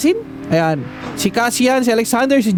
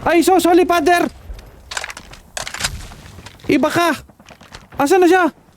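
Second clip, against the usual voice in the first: startled shouts; 9 semitones higher than usual. Real, not acted. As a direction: yelp it out, caught off guard by an enemy. Sudden enemy in the game. (gulat na sigaw)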